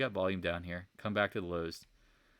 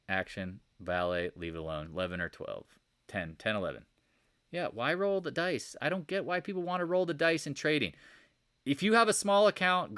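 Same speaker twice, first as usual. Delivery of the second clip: ordinary delivery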